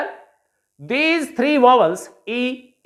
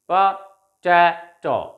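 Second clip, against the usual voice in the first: potato stretched slowly, syllable by syllable; slow, clear classroom model of potato; slow syllable drill; 4 semitones lower, much slower than usual. Shown as a demonstration. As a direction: draw out potato for students to repeat. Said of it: "recite them after me".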